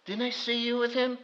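lightly voiced girl asking, quiet; girl questions Peter in a small voice; little girl. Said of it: "One little girl says".

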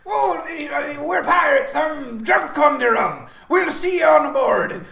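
high, loud, drawn-out pirate voice. Acted. Pirate introducing the crew to a newcomer, loud slow pirate shout. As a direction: stretch it out like a stage pirate. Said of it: "so the pirates say".

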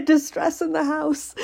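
high mock dog alarm; voicing the dog. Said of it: "He's like".

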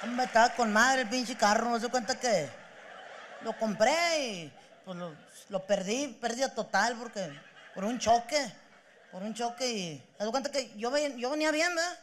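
lightly voiced grieving guy; teary [name].

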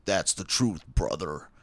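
rough stab at a wrestler impression; half-formed wrestling-star catchphrase voice; Hulk Hogan. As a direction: do an uncertain pro-wrestler voice. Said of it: "I'm doing Hulk Hogan or Randy Savage".

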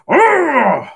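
high, loud strangled yell; Ackbar death cry. Retold or imitated. Podcaster imitating Admiral Ackbar's dying yell. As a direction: yell like a fish admiral hit. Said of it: "Admiral Ackbar who goes".

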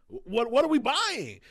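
retold pressing question to a distracted friend; exasperated. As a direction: push the question to get through.

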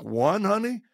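mock shock at a single session; teasing disbelief aimed at his wife; incredulous.

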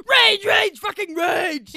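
high, loud hammed-up rage chant; mock rage. Acting out the hormone monster, high and loud. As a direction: roar it like a cartoon monster.

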